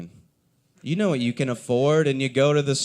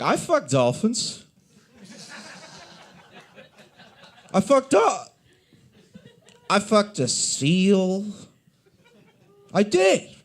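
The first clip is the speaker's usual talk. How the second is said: mock confession; 8 semitones higher, 3 dB louder, slower than usual. Imagined confession to the cashier.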